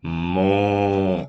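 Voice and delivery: low, drawn-out mock moo like an ox. Shown as a demonstration. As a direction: moo like a cow for the kids. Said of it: "Como o boi".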